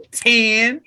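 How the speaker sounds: mock the word "Ten." with a feisty flick; mocking snap. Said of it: "He would mock me".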